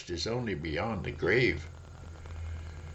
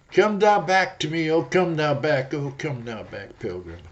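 the world coaxing; 5 semitones higher, 4 dB louder than usual. Acted. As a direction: lure him back, loud then dying away.

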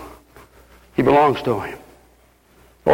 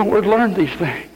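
usual speaking voice

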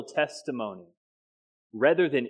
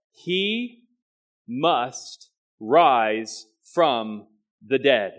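"must" drawn out slowly with weight; stress on must; 5 dB louder, much slower than usual. Shown as a demonstration. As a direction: lean hard on "must" in "He must rise from the dead". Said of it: "that word "must" is where I want to put the emphasis".

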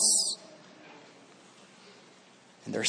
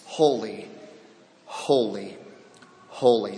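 soft low reverent holy, holy, holy; hushed holy; 11 semitones lower, 5 dB quieter than usual. Real, not acted. Preacher to the congregation, hushed reverent repetition. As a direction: let each holy fall softly.

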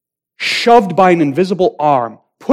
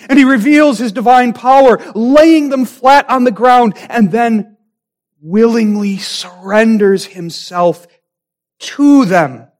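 higher, louder, driving climax; preaching at full force; 6 semitones higher, 4 dB louder than usual. Acted. Pastor to congregation at the climax, preaching at full force. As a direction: build the sermon to its peak.

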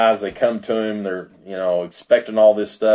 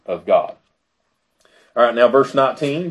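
usual speaking voice

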